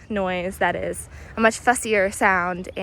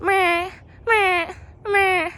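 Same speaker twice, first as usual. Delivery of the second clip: high harsh squawks well above her voice; blue jay squawk; 10 semitones higher, much slower than usual. Shown as a demonstration. Guide shows viewers the blue jay's flying noise, high squawk. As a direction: mimic the jay's flight call. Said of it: "they generally make this noise".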